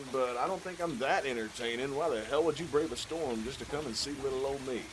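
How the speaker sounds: usual speaking voice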